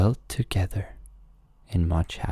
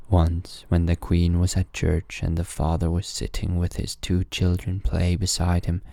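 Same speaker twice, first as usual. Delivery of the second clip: ordinary delivery, nothing marked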